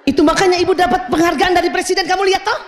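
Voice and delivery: loud, high bragging; mother bragging about her award, loud and high; boastful mother. Acted. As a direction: show off to the child.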